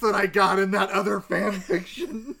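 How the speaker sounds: loud, high, laughing delivery; cracking up mid-sentence; laughing loudly. Pretend.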